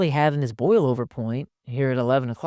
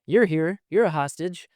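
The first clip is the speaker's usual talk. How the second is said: high-pitched imitation of an anxious wife; acted wife, urgent and high; freaked-out wife; 6 semitones higher, 4 dB louder than usual. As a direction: pounce on a captive listener, pitched up. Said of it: "And she's going".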